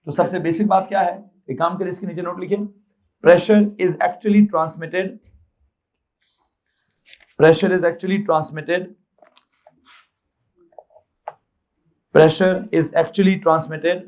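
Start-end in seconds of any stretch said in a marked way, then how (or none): 3.2-14.0 slow, measured teacher dictation; dictation pace. Real, not acted. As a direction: dictate slowly so students can write it. Dictating a sentence to note down.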